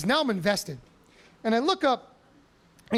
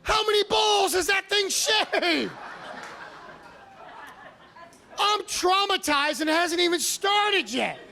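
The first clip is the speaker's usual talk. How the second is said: comic disbelief; 8 semitones higher, slower than usual. Real, not acted. Disgusted aside, high and slow.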